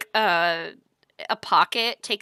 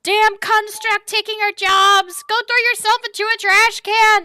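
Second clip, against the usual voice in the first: angry shout; 10 semitones higher, 5 dB louder than usual. Acted. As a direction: yell it at the robot. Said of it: "even screams out something".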